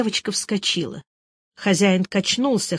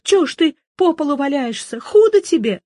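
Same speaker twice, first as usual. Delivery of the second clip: higher, louder desperate pleas; panicked pleading; 7 semitones higher, 4 dB louder than usual. Acted. Girl pleading with her grandfather on the floor, panicked. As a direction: beg him to get up.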